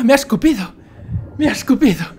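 high, loud excited outcry; mock shock. Gamer just spat out by the dragon, high and loud. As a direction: blurt it out amazed and relieved.

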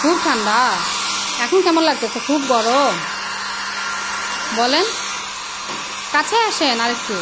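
loud over a hair dryer; talking over noise. Real, not acted. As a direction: raise your voice over the blower.

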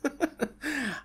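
high villain cackle; evil laugh. Acted. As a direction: cackle like a dark lord. Overlord laughs.

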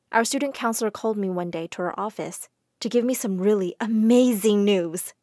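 usual speaking voice

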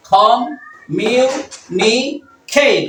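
very slow "communicate" in four separate parts; long pause after each syllable of "communicate"; teacher's slow syllable demo. Shown as a demonstration. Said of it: "কয়টা হলো?".

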